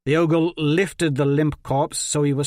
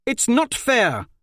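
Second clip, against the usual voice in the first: much higher, slow protest; indignant shout; 10 semitones higher, 3 dB louder, slower than usual. A narrator's reading. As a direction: protest the injustice loudly. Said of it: "Jondralin shouted".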